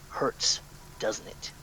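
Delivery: hushed, voiceless taunt; stage whisper. Acted. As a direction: whisper "Hurts, doesn't it?" as a menacing taunt. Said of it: "in a stage whisper say".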